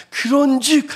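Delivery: high-pitched imagined apostolic shout; impassioned cry. Retold or imitated. Preacher voicing how Paul felt, impassioned cry. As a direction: cry it out like an orator. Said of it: "하면서 막 외쳤을 거예요".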